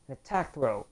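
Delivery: normal talking voice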